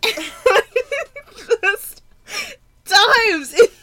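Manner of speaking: high, loud squeal through laughter; slow, helpless, squeaky laughing delivery; laughing hard. Real, not acted. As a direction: force the words out while cracking up. Cracking up at retold dive.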